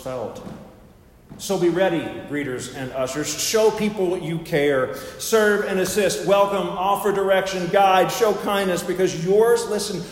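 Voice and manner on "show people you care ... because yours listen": emphatic charge, lifting in pitch and volume; preaching. Real, not acted. Preacher exhorts greeters and ushers to show kindness, rousing preaching. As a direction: urge them on item by item.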